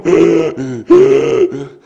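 a harsh, loud wheezing gasp for air; loud imitated gasp of a croupy child; imitated croup gasp. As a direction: mimic a child fighting to breathe. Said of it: "with Hannah in the back seat going".

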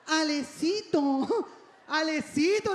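slow, drawn-out pleading name call; victim voice. Retold or imitated. Father wheedling his son for a favor, victim voice. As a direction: whine the name like a helpless victim. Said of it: "Pone voz de víctima".

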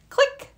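a very high, sharp click sound; camera click. A narrator's reading. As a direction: pop it out like a camera shutter. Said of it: "said the camera".

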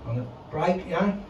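ordinary talk

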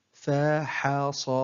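very slow, each syllable drawn out separately; broken into syllables. Shown as a demonstration. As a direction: sound out the word syllable by syllable. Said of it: "تقطع الكلمة إلى حروف". (تقطيع الكلمة ببطء شديد مقطعًا مقطعًا)

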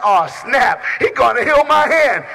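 loud, high amazed outcry; the crippled man realizing he is healed; excited shout. Retold or imitated. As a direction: burst out in disbelief. Healed man's excited reaction.

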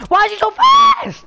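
high, loud frantic shout; frantic high shout at the chase; panicked shout. Real, not acted. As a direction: yell it in a panic.

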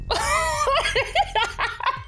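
long high real laugh. Real, not acted. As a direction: burst out laughing at a funny face. Laughing at the husband's face.

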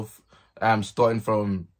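ordinary delivery

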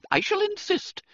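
high, insistent schoolmistress; firm headmistress. Acted. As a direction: brook no refusal. Headmistress cuts in firmly.

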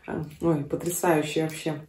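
speaking with food in mouth; mumbling between bites; chewing while talking. Real, not acted. Seen 2: Unclear which speaker; girl bites and chews, woman mouth seems full.

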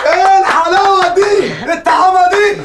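high, slow, gushing flattery; gushing praise. Acted. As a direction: fawn over him in delight. Flattering the official, over the top. (إطراء مبالغ فيه بصوت عالي وبطيء)

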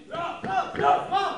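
high, loud cadence count. Real, not acted. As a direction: shout out the step count.

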